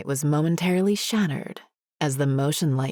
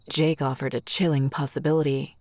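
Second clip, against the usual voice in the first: ordinary delivery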